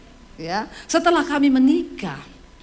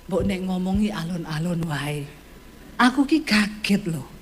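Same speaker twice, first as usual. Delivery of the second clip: lower, softer, a calm Javanese husband; 5 semitones lower, 4 dB quieter than usual. Retold or imitated. Husband asking wife to speak softly, lower and softer.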